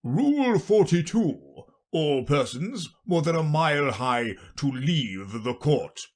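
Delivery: stately royal announcement; king reading out. Acted. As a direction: proclaim the rule grandly, drawing out the opening. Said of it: "read out from his book".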